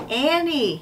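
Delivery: high call across a crowd. Acted. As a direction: shout her name to find her. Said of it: "he called".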